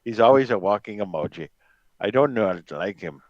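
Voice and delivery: a light take on a stern team principal; Toto Wolff impression. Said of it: "That's my Total Wolf impression for you".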